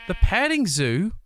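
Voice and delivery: slow, high and loud sign reading; delighted read. Real, not acted. Reading the petting zoo sign to chat, delighted. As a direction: savour each word of the sign.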